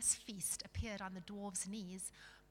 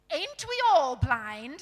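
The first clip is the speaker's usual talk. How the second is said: slow, loud, higher retort; scornful dwarf retort; 5 semitones higher, 15 dB louder, slower than usual. Acted. Dwarves retorting to the king, scornful. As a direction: throw it back loudly and slowly.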